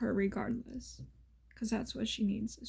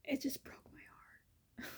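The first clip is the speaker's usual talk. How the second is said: tearful whisper; 4 semitones higher, 13 dB quieter, faster than usual. Real, not acted. Telling viewers about the grandmother, whispering through tears. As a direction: voice failing into a whisper. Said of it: "I'm gonna start crying".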